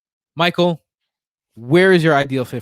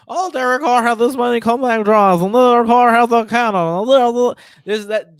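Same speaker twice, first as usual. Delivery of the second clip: mock fanboy gushing; 7 semitones higher, 4 dB louder than usual. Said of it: "he wants to talk about".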